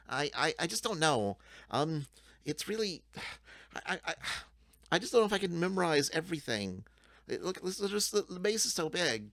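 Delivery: high, stammering anxious goblin voice; a timid goblin losing its nerve; nervous goblin. Acted.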